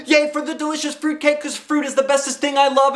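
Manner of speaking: high, loud gleeful chant; excited. A narrator's reading. Junie B cheering about the fruitcake, high and gleeful. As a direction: cheer for your favourite.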